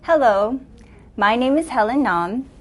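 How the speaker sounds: ordinary talk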